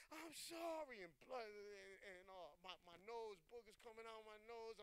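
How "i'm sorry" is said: high, pleading mock scream; retold scream. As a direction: imitate crying out in remorse. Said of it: "and I scream".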